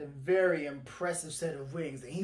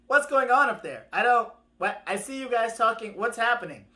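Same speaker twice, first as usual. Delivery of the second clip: loud, raised questioning voice; curious tortoise; 4 semitones higher, 8 dB louder than usual. Acted. Mister Tortoise asks the bird what's up, loudly curious. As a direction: fire off questions to someone above you.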